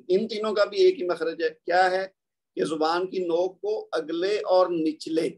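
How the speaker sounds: ordinary delivery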